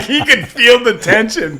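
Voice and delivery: ordinary talk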